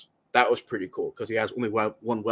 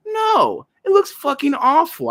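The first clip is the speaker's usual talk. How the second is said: very high, exasperated cry; outraged rejection; 4 dB louder, slower than usual. Acted. Fan rejecting the filmmakers' excuse, outraged. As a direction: squeal it out in disgust.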